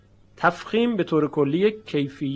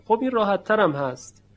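ordinary talk, nothing marked

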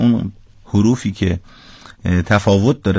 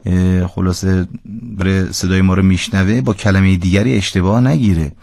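normal talking voice

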